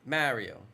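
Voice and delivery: "Mario" with the A of "marry"; mispronounced Mario. Shown as a demonstration. Streamer showing their pronunciation of the A, "Mario" as MARE-ee-oh. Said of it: "like an A".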